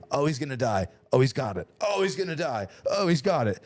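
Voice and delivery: alternating alarm and relief, rising in pitch; retold panic and relief. Friend retelling watching a buddy slip, mock panic. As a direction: flip between he's gonna die and he's got it. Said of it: "And I'm like".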